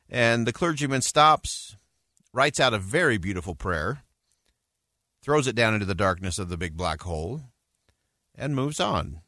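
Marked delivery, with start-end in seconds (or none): none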